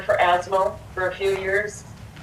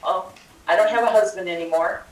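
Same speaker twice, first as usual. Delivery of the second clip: ordinary talk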